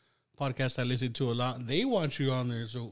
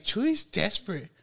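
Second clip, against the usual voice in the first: mocking high voice; 10 semitones higher than usual. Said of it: "you're like".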